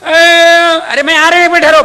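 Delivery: high, loud harried wife; busy wife shouting at her sick husband from the next room. Acted. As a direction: yell back from the kitchen, busy. Wife calls back.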